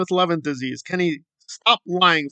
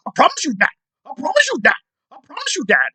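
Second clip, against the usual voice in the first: higher mock voice, droning the same promise; mocking repeated promise; 8 semitones higher, faster than usual. Vlogger mocking a man's empty promises, higher parody voice. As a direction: parrot him like a broken record, pitched up. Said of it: "He just says that over and over again".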